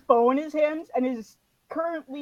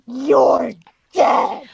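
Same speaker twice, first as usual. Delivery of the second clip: louder, slow, deadly promise; player's character threatening her foe, loud and menacing; menacing threat; 9 dB louder than usual. Acted. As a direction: spit it out as a death sentence. Threatening the enemy.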